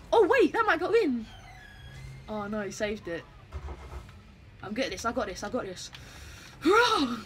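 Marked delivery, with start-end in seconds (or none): 0.1-1.3 louder and higher, sudden rush of excitement; excited alarm. Real, not acted. Ball heading for goal. 6.6-7.2 loud, high hurrah; a big victory shout; cheer. Real, not acted. Cheering in game.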